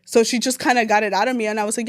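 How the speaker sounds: normal talking voice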